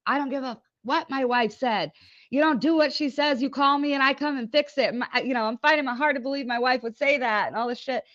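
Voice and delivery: pissed off. Retold or imitated. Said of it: "was already pissed off".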